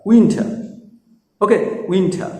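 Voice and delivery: উইন্টার as "win-tha"; উইন্টার with aspirated t; correct pronunciation. Shown as a demonstration. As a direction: drop the final r in উইন্টার. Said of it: "সঠিক উচ্চারণগুলো দেখো".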